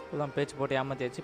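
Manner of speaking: ordinary talk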